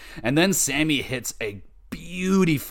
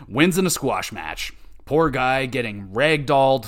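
ordinary delivery

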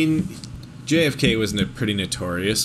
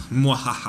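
evil laugh. Pretend. Joking about their diabolical ploy.

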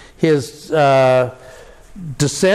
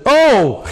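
high, loud groaning "Oh"; a short wailing "Oh"; anguished cry; 8 semitones higher, 4 dB louder than usual. Shown as a demonstration. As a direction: groan it out in pain. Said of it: "you almost get always this".

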